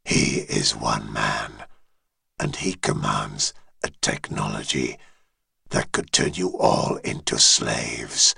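very low, distant dragon voice; deep dragon voice. Acted. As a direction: speak as an ancient dragon, far below. Dragon head explains.

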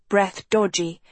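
ordinary delivery, nothing marked

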